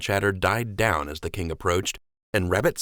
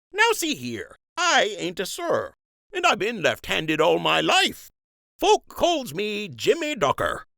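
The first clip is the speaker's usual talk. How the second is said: higher, slow country dockhand; folksy dockhand, pitched up; rustic docker voice; 12 semitones higher than usual. Acted. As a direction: talk like a plain-spoken old docker.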